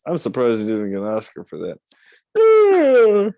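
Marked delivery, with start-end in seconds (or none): 2.4-3.3 much higher, loud, far off mock childlike voice; hammed-up high kid's voice calling down from a pole; mocking Gilbert Grape character. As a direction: squeal it like a boy stuck up a pole. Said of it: "when he was playing a".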